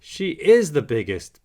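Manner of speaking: realizing. A narrator's reading. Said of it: "Bingo realizes".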